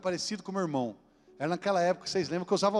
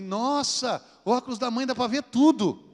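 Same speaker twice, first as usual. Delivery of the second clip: higher, wide-eyed exclamation; amazed child; 5 semitones higher, 6 dB louder, slower than usual. Retold or imitated. Little girl trying on her mother's glasses, amazed. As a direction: marvel like a kid making a discovery.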